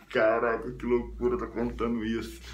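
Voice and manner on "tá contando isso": ordinary talk, nothing marked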